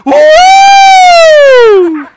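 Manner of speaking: very high, loud laughing whoop; cheering whoop. Retold or imitated. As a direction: whoop like an excited crowd in the front row. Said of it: "people sitting on the front row would go".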